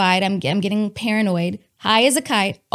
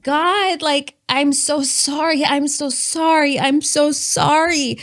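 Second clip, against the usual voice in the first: higher-pitched, drawn-out repentant plea; pleading apology; 6 semitones higher, slower than usual. Retold or imitated. Praying to God while high and embarrassed, pleading apology. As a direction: plead for forgiveness, stretching each apology. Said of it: "I'm praying to God".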